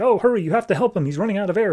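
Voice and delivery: high, fast and frantic; urgent plea. Retold or imitated. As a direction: rush the words, begging for help. Scientist urging help for dying kid.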